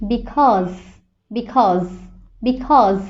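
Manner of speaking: Because with a buzzing z at the end; z sound in because. Shown as a demonstration. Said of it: "কারণ শব্দটার ইংরেজি কি?".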